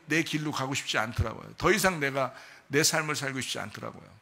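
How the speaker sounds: ordinary delivery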